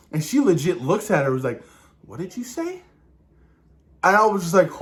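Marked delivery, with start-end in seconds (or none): 2.1-2.8 soft, higher, rising challenge; imitated hushed confrontation; quiet menace. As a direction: ask it in a dangerous hush. Acting Wanda's menacing challenge.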